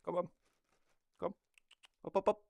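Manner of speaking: high, loud call to the cat. Real, not acted. Owner calling cat to jump up, high loud call. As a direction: chirp the cat up onto you.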